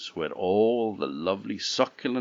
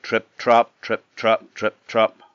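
punchy trip-trap hoofbeats, louder on the beats; heavier footsteps; 3 semitones higher, 6 dB louder than usual. A narrator's reading. Middle goat crossing the bridge, heavier footsteps. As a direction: tap out a goat's heavier steps. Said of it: "footsteps were a bit heavier".

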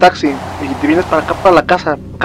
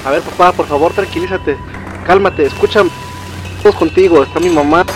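anxious calming; 4 semitones higher, 3 dB louder than usual. Acted. Child calming panicking father.

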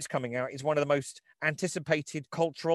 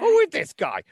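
put-on high, loud New York wise-guy accent; 7 dB louder than usual. Host hams up a New York wise guy, loud. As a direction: bark it like a New York tough. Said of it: "this is how they talk in New York City".

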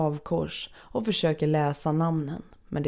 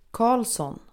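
calling out a name; 7 semitones higher than usual. A narrator's reading. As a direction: call "Karlsson" across the churchyard. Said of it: "ropar någon".

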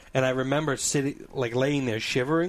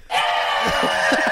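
loud, hammed-up mock shriek; Rob's scream; 12 semitones lower, 5 dB louder than usual. Storyteller imitates his friend with a bitten finger, mock scream. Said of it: "And Rob just goes".

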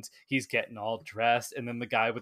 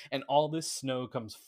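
ordinary talk, nothing marked

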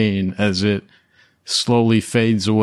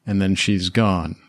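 usual speaking voice

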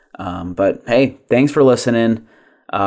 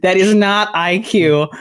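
raised pitch, giggling host intro; high energy; 8 semitones higher than usual. Real, not acted. Host introducing the episode amid interruptions, high energy. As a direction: push through the intro, giddy and laughing. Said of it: "a little bit more high energy".

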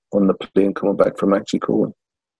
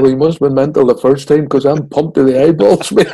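laughing, louder, half-shouted quip; laughing through it; 6 semitones higher, 7 dB louder than usual. Retold or imitated. To the host, retelling his joke to Cooper, laughing through it. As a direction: crack yourself up as you tell it.

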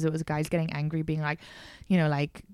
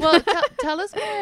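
laughing, higher pitch; 10 semitones higher, 5 dB louder than usual. Real, not acted. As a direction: laugh into the next question. Laughing, moving the chat on.

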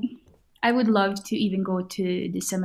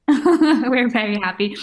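laughing; 3 semitones higher, 5 dB louder than usual. Real, not acted. Laughing while answering about hopes.

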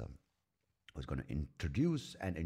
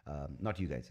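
ordinary talk

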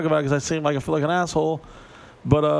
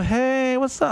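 high-pitched mock girly greeting; 9 semitones higher, slower than usual.

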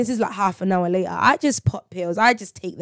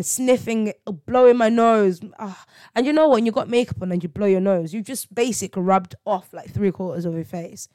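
usual speaking voice

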